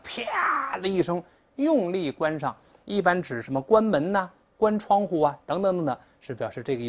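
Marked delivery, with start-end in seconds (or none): 0.0-0.8 high, stretched-out "pah" bang; imitated slam noise. Shown as a demonstration. Teacher mimics a door slamming, long high-pitched noise.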